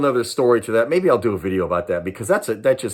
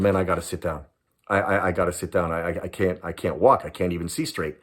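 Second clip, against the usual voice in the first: lower, softer, weak pleading; barely holding up, fading out; dizzy and faint; 6 semitones lower, 5 dB quieter than usual. Retold or imitated. Dizzy, bleeding, asking to sit.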